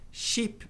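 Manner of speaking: "Sheep" with a long, stretched ee sound; long ee vowel. Shown as a demonstration. Teacher shows the long ee in "Sheep". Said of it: "dois E's tem som longo de I".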